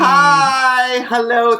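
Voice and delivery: high, loud, drawn-out hi; effusive hello; showy greeting. Real, not acted. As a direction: make a grand entrance with one long greeting. Guest's showy entrance greeting.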